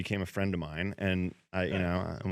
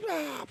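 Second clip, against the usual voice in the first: roommate's roar. Retold or imitated. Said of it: "He goes".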